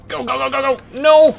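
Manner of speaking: urgent high shouts; frantic yelling. Real, not acted. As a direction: yell it in a panic. Panicked play, yelling at game.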